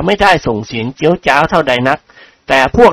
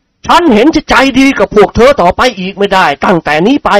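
higher, stern scolding lecture; angry lecture; 6 semitones higher than usual. A narrator's reading. Headmaster scolding his rowdy students, stern and higher. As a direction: scold the class, holding back rage. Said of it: "โกรธจนตัวสั่น".

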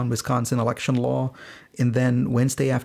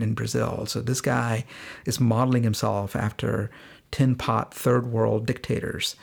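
ordinary talk

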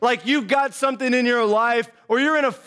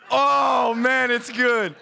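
satisfied refreshed sigh; much slower than usual. Shown as a demonstration. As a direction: sound like the first sip of a cold drink. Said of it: "it sounds just like that".

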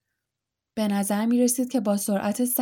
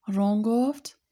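ordinary delivery

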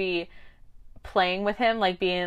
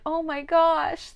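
mock surprise; 9 semitones higher, much slower than usual. Characters react.

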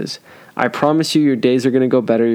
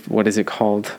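ordinary talk

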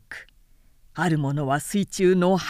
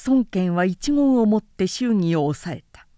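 plain reading voice

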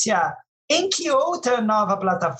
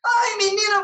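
excited squeal; 10 semitones higher than usual. Real, not acted. Squeals in response to teasing.

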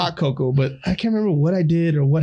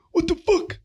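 friends' parody of his angry cussing, pitched way up; mocking impression of himself; slower than usual. Friends mimic his cussing, gasping much higher squeaky outburst. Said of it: "make fun of each other, our voices".

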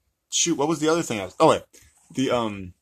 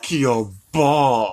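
very slow, louder incantation; hammed-up wizard spell; parody spell-casting; 5 dB louder, much slower than usual. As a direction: drag out the final word absurdly.

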